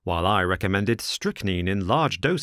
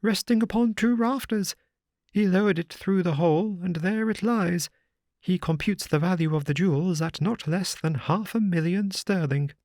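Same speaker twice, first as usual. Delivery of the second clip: a raised, fussy gentleman's voice; higher, eager little man confiding a secret; lightly voiced Thaddeus; 10 semitones higher than usual. A narrator's reading. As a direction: Thaddeus Sholto telling of the treasure in a higher voice.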